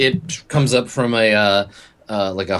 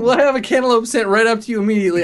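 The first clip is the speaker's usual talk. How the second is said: much higher, fast frightened gabble; rushed panicky promise; 12 semitones higher, 3 dB louder, faster than usual. Acted. Clerk hurrying to appease the furious guest, fast and high. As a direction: blurt it all out fast in a panic.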